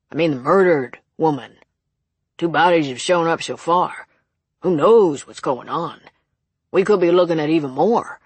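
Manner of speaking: put-on old local clerk, loud and emphatic; elderly cashier, alarmist. Old cashier telling visitor about the murders, loud and emphatic. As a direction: punch it out as the gossipy old cashier.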